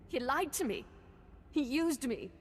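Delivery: bitter hurt. Acted. Betrayed apprentice realises the truth.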